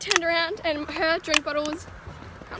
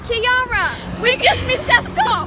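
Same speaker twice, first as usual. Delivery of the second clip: loud, higher, exasperated telling-off; a loud, higher fed-up retort; annoyed outburst; 6 semitones higher, 11 dB louder, slower than usual. Retold or imitated. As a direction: scold her for the missed stop.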